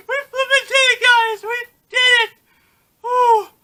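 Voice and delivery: half-crying, half-cheering with joy; giddy relief. Real, not acted. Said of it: "calm down, [name]".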